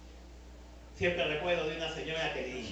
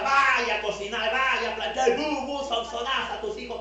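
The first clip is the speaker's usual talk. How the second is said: retold scolding yell, higher and louder; mother yelling at a neighbour to get up; mock shouting; 6 semitones higher, 5 dB louder than usual. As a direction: bark orders at a woman who stays in bed. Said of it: "mi mamá la grita".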